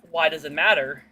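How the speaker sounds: usual speaking voice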